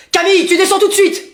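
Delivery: loud, high paternal command; stern father's order. Acted. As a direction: order a child down at once. Father orders child downstairs.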